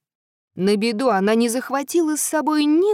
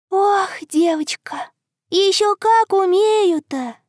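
sighing moon voice; 7 semitones higher than usual. Acted. Moon replies sadly.

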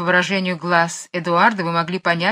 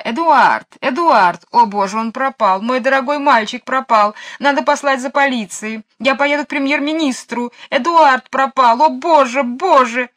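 громкий, высокий отчаянный крик; кричит, ломая руки; крик в отчаянии; 6 semitones higher, 6 dB louder than usual. A narrator's reading. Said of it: "ломая руки и крича". (мисс Ларк зовёт пропавшую собаку, громко и высоко)

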